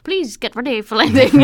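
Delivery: a hint of a Turkish pilot; Turkish accent. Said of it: "That's a Turkish accent".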